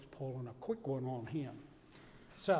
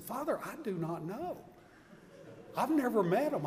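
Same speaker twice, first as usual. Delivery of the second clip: higher and louder, helpless; exasperated protest; 8 semitones higher, 4 dB louder than usual. Retold or imitated. Assistant answering the priest, exasperated protest. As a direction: throw up your hands at the question.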